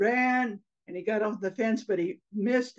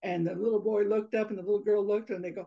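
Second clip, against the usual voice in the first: ordinary delivery, nothing marked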